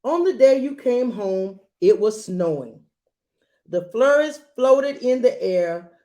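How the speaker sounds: put-on gentle read-aloud to a child; storybook reading voice; story narration. A narrator's reading. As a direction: read it like a bedtime picture book. Reads picture book aloud.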